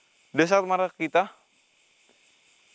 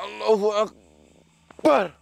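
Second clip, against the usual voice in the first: very slow, higher cry of Allahu Akbar; drawn-out invocation; 6 semitones higher, much slower than usual. Real, not acted. Ghost hunter locking the spirit with a slow drawn-out cry. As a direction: stretch each syllable as a battle cry. Invoking God against a spirit.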